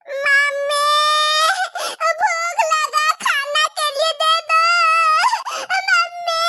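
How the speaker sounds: put-on high whiny little girl begging for food; child sobbing for mummy; hungry crying child. Small daughter crying to her father for food, high and whiny.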